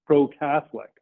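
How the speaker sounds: normal talking voice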